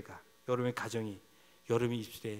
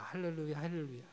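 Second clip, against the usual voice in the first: ordinary delivery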